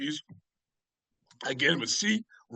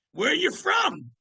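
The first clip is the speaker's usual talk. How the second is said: lively [name] voice; 12 semitones higher, slower than usual. Retold or imitated. [name] greets the Boston bartender backstage in a high, lively voice. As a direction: pitch it way up like an eager rock star saying hello.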